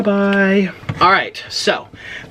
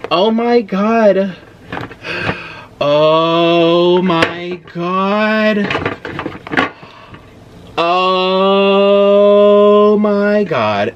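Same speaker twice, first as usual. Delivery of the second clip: slow, drawn-out oh my God; long stunned marvel; amazed; 2 semitones higher, 4 dB louder, much slower than usual. Real, not acted. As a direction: savour each word in awe. Overwhelmed by huge lobster.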